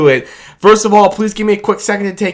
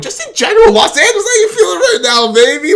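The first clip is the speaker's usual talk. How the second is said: much higher, laughing shout-out; excited laughing call; 12 semitones higher, 3 dB louder than usual. Real, not acted. Vlogger asking LA fans how they feel: laughing excited call.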